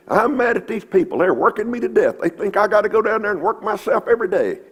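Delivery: hammed-up cranky elderly lady; mock grumpy widow. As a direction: whine it like a fed-up old woman. Voicing cranky widow in story.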